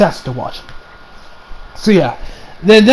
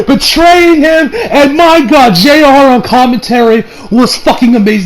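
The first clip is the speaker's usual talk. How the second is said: usual speaking voice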